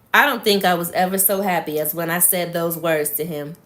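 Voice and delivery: usual speaking voice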